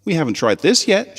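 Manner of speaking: put-on a keen young pony showing her book; high little filly voice. Sweetie Belle showing her friends the page, high and eager.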